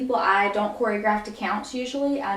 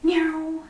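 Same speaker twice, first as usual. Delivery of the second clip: a meow, higher; playful meow; 6 semitones higher than usual. Shown as a demonstration. Teacher imitating the song's meow, higher. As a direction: purr out a quick meow.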